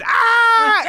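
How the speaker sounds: wild high scream; high terrified shriek. Retold or imitated. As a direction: let out a shocked scream. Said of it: "she screams".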